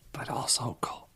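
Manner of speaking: hushed aside whisper. Real, not acted. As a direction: slip the word in under your breath. Sneaking in a cheeky word.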